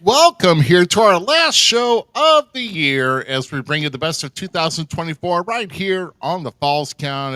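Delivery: big, loud, high-pitched host welcome; show-opening announcer. Acted. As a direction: belt out the show opening to the crowd.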